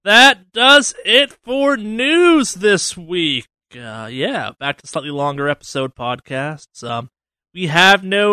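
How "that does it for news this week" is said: announcer. Acted. Host closing segment.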